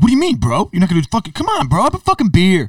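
loud, high mock outrage; hammed-up peer pressure at high pitch; pushy, offended drinker. Acts pushy friend offended by refusal.

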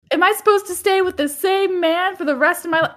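high, fast mocking whine; mock whiny Carrie. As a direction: send up her whining, rushed and high. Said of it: "she's like".

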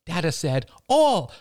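put-on high, protesting child's voice; little boy crying out. A narrator's reading. Son cries out to mother that father allowed it. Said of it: "cried Ben".

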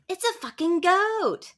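put-on high, loud, slow kid exclaiming; playful high-pitched kid impression; little kid voice. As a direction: imitate a small child announcing it. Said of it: "that little kid that goes".

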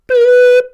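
imitated high electronic tape beep. Imitating the school listening test beep, piercing high and loud.

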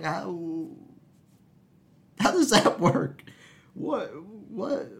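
very high, incredulous squeal of a question; slow, high-pitched comic disbelief; mock bafflement. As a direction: act out total bewilderment, voice way up. Retold bafflement at talking ladies.

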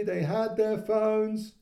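high, slow called-out words; boy shouting. A narrator's reading. Toby calling back to his mother about the phones. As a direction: call it back across the room. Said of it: "shouted Toby".

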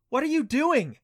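high, loud demanding question; demanding shout. A narrator's reading. Banshee girl landing and demanding, high and loud.

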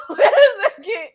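loud, high laughing shriek; helpless high-pitched laughing; cracking up. Real, not acted. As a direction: squeal it through real laughter. Cracking up at co-host's joke.

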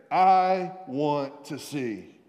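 each word stretched out; slow, deliberate. Shown as a demonstration. Preacher prompting neighbours to repeat, slow and deliberate. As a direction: spell it out slowly for others to repeat.

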